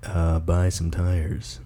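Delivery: low, slow radio ad voice; deep commercial voice. Shown as a demonstration. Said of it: "give us a little commercial".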